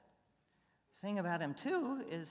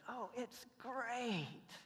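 put-on slow, higher child's wonder; little girl; 7 semitones higher, 3 dB quieter, much slower than usual. [name] echoing Tiffany to Luis, slow and higher. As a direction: stretch it out in gentle delight. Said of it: "Tiffany, who now can come in and say".

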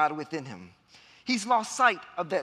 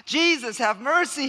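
loud, raised cry for mercy; pleading call; 4 semitones higher, 5 dB louder than usual. Retold or imitated. Blind beggar calling Jesus again, pleading loudly. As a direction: call out over the crowd. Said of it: "he says".

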